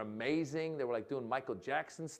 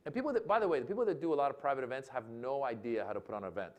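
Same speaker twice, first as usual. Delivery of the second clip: ordinary delivery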